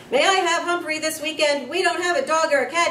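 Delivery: put-on loud, high, fast boyish bellow; a kid bellowing across the class. A narrator's reading. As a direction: blurt it out eagerly and loud. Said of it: "he bellowed".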